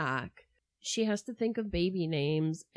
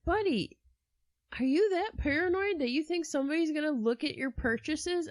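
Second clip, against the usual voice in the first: exasperated scolding; 9 semitones higher than usual. Retold or imitated. Speaker scolding Castro, exasperated.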